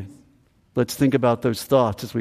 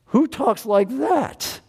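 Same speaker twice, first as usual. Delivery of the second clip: amazed; 10 semitones higher, slower than usual. Real, not acted.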